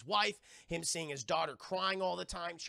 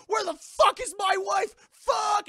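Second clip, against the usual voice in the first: very high, shouted outburst; furious demand; 11 dB louder, slower than usual. Retold or imitated. Patient demands his wife from doctor, furious shout. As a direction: yell it in frantic anger.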